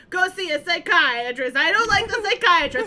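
playful imitation of a therapy argument; imitating the character. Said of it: "he's like".

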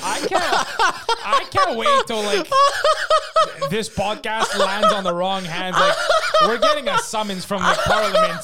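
barely getting it out between laughs; laughing. Real, not acted. Joking to his co-host about getting summoned, laughing hard.

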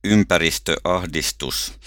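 ordinary delivery

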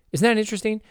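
ordinary delivery

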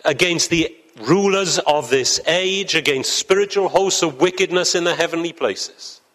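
rising, fervent pulpit delivery; preaching at full force. Acted. Preacher rousing the congregation about the spiritual battle, fervent. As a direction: build it like a call to battle.

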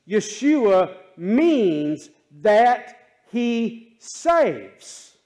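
loud, slow emphatic preaching; rising preacher fervor; building sermon. Acted. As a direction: lift it up, each word weighed.

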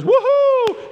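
high, loud drawn-out "woohoo"; whooping holler. Acted. Stranger in robes greets you at church, high loud whoop.

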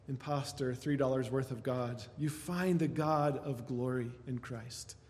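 ordinary talk

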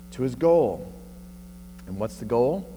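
ordinary talk, nothing marked

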